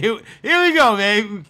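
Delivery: loud fed-up here we go; exasperated outburst. Real, not acted. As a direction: groan it out like you saw it coming. Exasperated at expected question.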